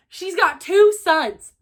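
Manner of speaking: high, loud, slow flat statement, falling on two; repeating the twist as fact; stunned statement. Real, not acted. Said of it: "repeats as statement".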